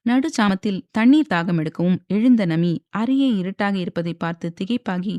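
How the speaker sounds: ordinary delivery, nothing marked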